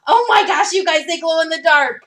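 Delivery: real delight at a surprise; excited. Real, not acted. Discovers ears glow in dark.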